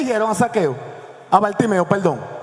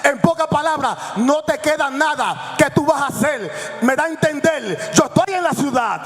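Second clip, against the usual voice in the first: fiery preaching; 8 semitones higher than usual. Acted. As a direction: preach it out with full force. Preaches about the crowd at full force. (predicación apasionada a toda voz)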